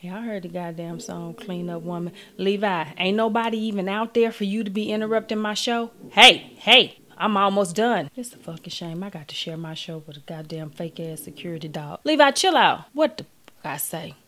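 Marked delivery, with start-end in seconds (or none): 2.3-8.1 scolding the dog for interrupting her show; yelling at the dog. Real, not acted. 12.0-14.2 sharp, loud, high command; telling the dog to chill out; yelling at the dog. Real, not acted. Scolding the dog.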